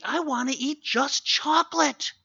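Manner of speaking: put-on high, pleading kid wanting chocolate; whiny child voice. Child begging parent for chocolate, whiny high voice. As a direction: play the bratty kid begging.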